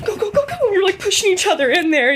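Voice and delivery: hiss-shout them forward in a panic; frantic excited urging; frantic urgent go. Retold or imitated. Girls rushing into dad's room.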